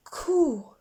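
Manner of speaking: put-on a startled boy's coo; surprised boy. A narrator's reading. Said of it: "said the boy".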